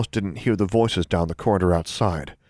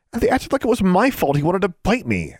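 put-on indignant girl; 12 semitones higher than usual. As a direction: finish the story outraged. Alexandra finishes indignantly.

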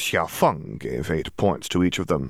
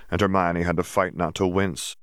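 ordinary delivery

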